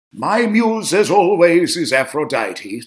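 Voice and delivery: a bad mock-posh British accent, played for laughs; horrible British accent. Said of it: "Forgive my horrible British accent".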